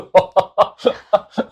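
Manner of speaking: loud high laugh; burst of laughter. Real, not acted. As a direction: crack up laughing. Laughing at the teacher joke.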